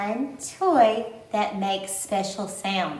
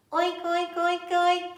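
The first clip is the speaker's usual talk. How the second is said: pig oinks; 6 semitones higher than usual. Shown as a demonstration. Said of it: "Can you make a pig sound?".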